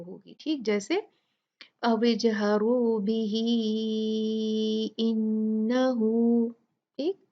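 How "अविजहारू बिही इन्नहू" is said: slow melodic Quran recitation, बिही stretched long into इन्नहू; tajweed recitation with long madd; joined recitation. Shown as a demonstration. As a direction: chant अविजहारू बिही इन्नहू in one drawn-out flowing line. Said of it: "तो ऐसे पढ़ेंगे इसको".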